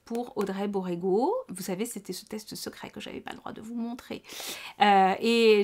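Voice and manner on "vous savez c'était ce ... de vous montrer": confiding whisper about a secret; hushed aside to viewers about the secret test. Real, not acted. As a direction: lean in and whisper it like a shared secret. Secret test she couldn't show.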